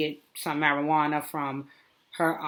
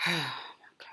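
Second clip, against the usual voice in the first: breathy sigh, then a whispered oh my God; exasperated whisper; 3 semitones lower, 11 dB quieter than usual. Real, not acted. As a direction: whisper it like you're done with her. Sighing in disbelief at the hysteria.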